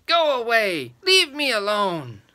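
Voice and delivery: high and loud, a cranky witch shrieking; witch's shriek. A narrator's reading. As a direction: chase them off, shrieking. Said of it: "she shrieked".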